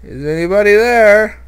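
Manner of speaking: scared call into the dark. Real, not acted. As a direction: afraid of what answers. Scared calling into cave.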